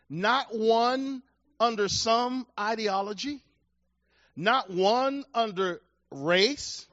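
each phrase slow and weighty; deliberate pulpit cadence; slow emphatic preaching. Acted. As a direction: hammer home the contrast.

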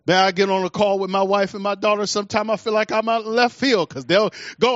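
usual speaking voice

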